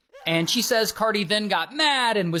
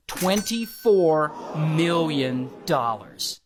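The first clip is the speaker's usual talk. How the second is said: slow, drawn-out announcement; dramatic reveal; 2 semitones lower, slower than usual. Real, not acted. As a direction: stretch out each word for effect. Big reveal after are you ready.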